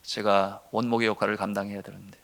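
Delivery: ordinary talk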